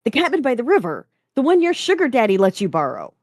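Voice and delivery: loud, high, fast and snappish; exasperated snap. A narrator's reading. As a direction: snap back in rising exasperation. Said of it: "Jason's voice cracked as he snapped".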